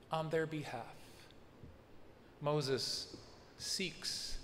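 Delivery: hushed solemn close. Real, not acted.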